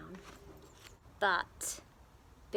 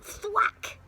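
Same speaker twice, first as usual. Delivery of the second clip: sound effect; 10 semitones higher, 4 dB louder than usual. Acted. Axe hits beanstalk.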